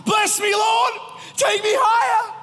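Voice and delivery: mock the shortcut worshipper, overeager; mock eager plea. Worshipper skips ahead to blessing, hammed-up eager plea. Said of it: "let's just skip to the bless me part".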